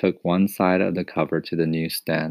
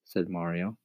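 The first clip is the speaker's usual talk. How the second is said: plain reading voice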